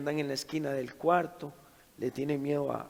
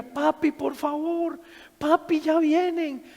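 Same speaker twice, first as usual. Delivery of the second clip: put-on high, loud, slow child's plea; terrified pleading; 12 semitones higher, 6 dB louder, slower than usual. Daughter begs her father to save her from the creatures, high and terrified. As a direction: beg for help as if something is coming.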